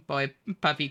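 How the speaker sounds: ordinary delivery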